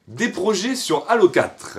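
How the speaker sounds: high, loud, drawn-out topic announcement; question read-out. Real, not acted. Vlogger announcing a viewers' question about Halo, high and loud.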